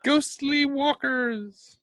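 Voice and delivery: high, drawn-out mock ghost wail; spooky ghost. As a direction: play a silly ghost, slow and high. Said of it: "we're ghostly".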